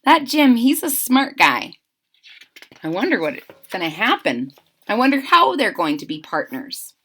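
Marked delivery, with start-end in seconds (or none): none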